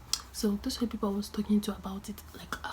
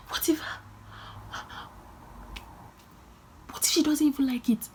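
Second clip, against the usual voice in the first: panicked dressmaker fretting aloud; panicked worry; 6 semitones higher than usual. Retold or imitated. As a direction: blurt out the fear.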